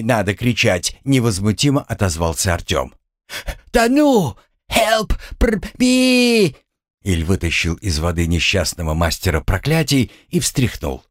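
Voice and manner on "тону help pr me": very high, spluttering drowning cries; wailing for help. Acted. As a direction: splutter and shriek as if going under. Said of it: "и завопил по-другому". (истошный вопль тонущего, захлёбываясь)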